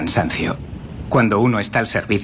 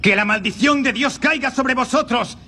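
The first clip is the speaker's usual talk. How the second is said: climbs higher and higher into wrath; rising furious curse; 12 semitones higher than usual. Acted. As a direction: curse them, building to a pitch. Monk curses the intruders.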